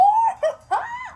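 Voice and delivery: loud, high squeal of a laugh; burst of laughter. Real, not acted. Laughing loudly as the piece finally fits. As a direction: let a surprised laugh burst out.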